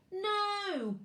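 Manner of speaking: high, loud cry of protest; a small boy refusing outright; child's cry. Acted. Max refusing to hand Fred the branch, high cry. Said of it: "cried Max".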